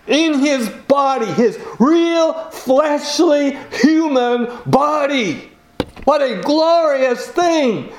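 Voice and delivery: fervent preaching. Acted. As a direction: proclaim it with rising wonder. Preacher exulting over the cross.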